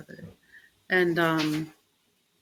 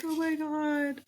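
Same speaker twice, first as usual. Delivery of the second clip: amazed; 10 semitones higher than usual. Retold or imitated. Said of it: "I would just be like".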